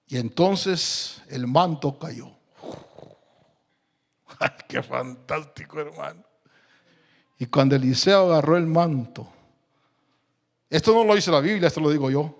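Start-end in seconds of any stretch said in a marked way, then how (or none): none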